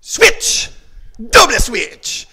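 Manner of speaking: loud, drawn-out drill call; called-out command. Real, not acted. Karate coach changing sides, loud slow command. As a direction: call the switch across the class.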